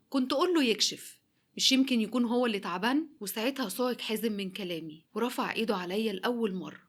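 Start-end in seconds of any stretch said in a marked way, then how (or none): 0.1-3.1 louder and higher, hurling it at him; rising fury, throwing the accusation in his face; angry outburst. Retold or imitated. Wife turns on her husband before his family, loud and furious.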